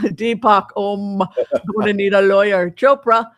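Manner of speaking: loud mock Indian accent; Deepak Chopra impression. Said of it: "Just because we're imitating".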